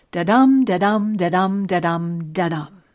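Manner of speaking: five da-dums, each dum stressed; iambic pentameter beat. Shown as a demonstration. Podcaster showing five iambs per line, iambic beat. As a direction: pound out dum five times, easing off after a loud start. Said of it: "five of those I ams per line".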